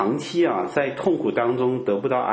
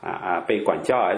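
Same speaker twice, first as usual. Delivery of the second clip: normal talking voice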